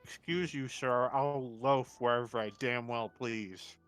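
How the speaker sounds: ordinary talk, nothing marked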